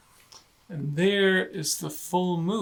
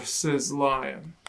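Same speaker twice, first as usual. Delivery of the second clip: ordinary delivery, nothing marked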